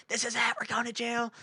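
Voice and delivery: high, small panicked imitation; mock freaking out. Imitating girls in the speeding car, high mock panic. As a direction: imitate girls sure they are doomed. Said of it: "Like, freaking out".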